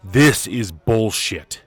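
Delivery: high, loud furious yell, slow on the last words; furious outburst. Acted. Character blowing up at the bad news, furious shout. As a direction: explode with contempt.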